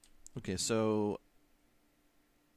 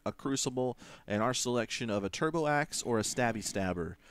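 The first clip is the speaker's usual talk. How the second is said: ordinary delivery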